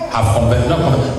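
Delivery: normal talking voice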